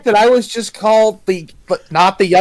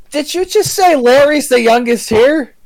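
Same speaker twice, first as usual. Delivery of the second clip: usual speaking voice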